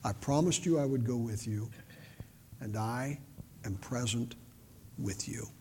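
low, very soft, with spaced phrases; the Lord reassuring. Retold or imitated. The Lord answering one who feels alone, quietly reassuring. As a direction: comfort quietly, letting each phrase settle. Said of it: "the Lord just says".

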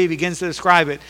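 usual speaking voice

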